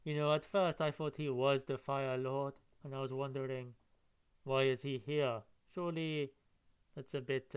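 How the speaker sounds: ordinary talk, nothing marked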